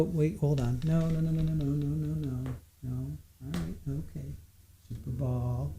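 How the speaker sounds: hushed, off-mic murmur; soft aside to the pet; quiet aside. Real, not acted.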